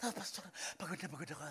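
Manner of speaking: soft, quick, worn-out whine; tired complaint. Pretend. Church member telling the pastor they are exhausted, soft and tired. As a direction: sound drained and quiet.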